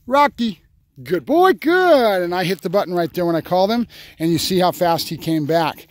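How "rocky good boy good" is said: higher, slow drawn-out praise; calling the dog. Real, not acted. Trainer calling his dog Rocky back, then slow high praise. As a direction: call him in, then stretch out the praise.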